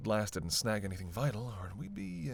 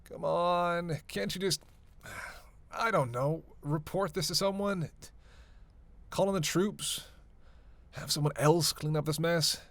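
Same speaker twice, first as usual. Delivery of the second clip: raised pitch, pushed exasperation; exasperated pleading; 6 semitones higher than usual. Acted. Begging partner to leave it to someone else, exasperated. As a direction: plead higher, stretching out the complaint. Pleading to avoid getting involved.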